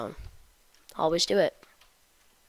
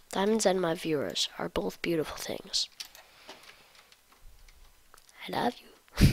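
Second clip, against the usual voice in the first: whispering. Acted. Whispered mock compliment to viewers.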